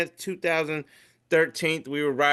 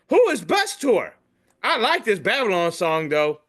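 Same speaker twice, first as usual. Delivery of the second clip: higher, louder puzzled wife voice; imitating wife; 8 semitones higher, 6 dB louder than usual. Wife asks about the tour, a higher imitated voice. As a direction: play her confused question. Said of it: "And she goes".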